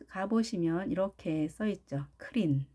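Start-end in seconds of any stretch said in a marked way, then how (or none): 2.2-2.7 클린 short, in Korean syllables; Korean loanword pronunciation. Shown as a demonstration. Teacher reading a laundry sign, short Korean-style 클린. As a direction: say 클린 as written in Hangul. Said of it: "우리 오늘부터 버립시다".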